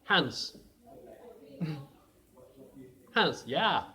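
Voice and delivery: loud, high call across the trenches, breaking into a laugh; a high yelled call with a laugh in it. Acted. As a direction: shout the name over to the enemy line. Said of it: "and he called".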